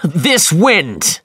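high, loud cry against the wind; captain cursing the wind, shouting; frustrated shout. Acted. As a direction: yell it into the gale. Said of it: "he shouted".

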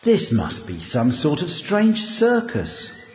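low, softened, slow musing voice; inner thought. A narrator's reading. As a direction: think it to yourself, puzzled. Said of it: "Hank thought".

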